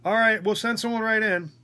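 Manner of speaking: high-pitched mock nurse on the intercom; imitated nurse. Nurse answers over the intercom.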